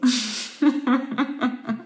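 rippling chuckle after admitting pleasure; pleased laugh. Real, not acted. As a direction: run of giggles. Laughs after saying she's happy.

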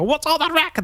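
grumpy neighbor. Pretend. The downstairs neighbor complaining about noise, high and cranky. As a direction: squawk like a fussy old neighbor. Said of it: "neighbors below are gonna go".

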